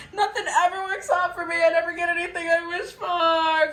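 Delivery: high, fast mock-tearful whine; whiny self-pity. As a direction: wail it like a hammed-up defeatist. Mimics negative self-talk.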